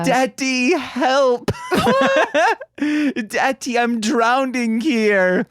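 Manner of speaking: high mock child pleading for daddy, hammed up; mock drowning child. Mocking his dad's focus on cursing, high childlike pleading. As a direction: whine it up high like a drowning kid begging dad.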